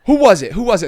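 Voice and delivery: loud, high-pitched demanding shout; yelled interrogation, acted out; drill instructor shouting. Drill instructor confronting recruits.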